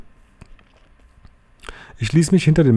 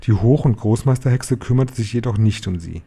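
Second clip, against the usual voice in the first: ordinary delivery, nothing marked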